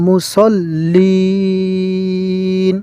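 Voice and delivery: মুসল্লিন with the final লিন drawn out slowly; lengthened ending. Shown as a demonstration. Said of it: "সর্বশেষ উচ্চারণে আমাদেরকে টান দিতে হবে লিন".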